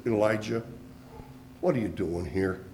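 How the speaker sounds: barely above a murmur, like God leaning close; the still small voice, low and hushed. A narrator's reading. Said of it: "there came a still small voice".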